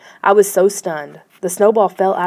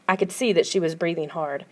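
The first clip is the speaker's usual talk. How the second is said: ordinary delivery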